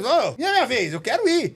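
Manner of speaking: put-on high, loud child clamouring for a turn; eager child. Waiting child begs the dentist for a turn, high eager clamour. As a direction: pipe up like an impatient kid.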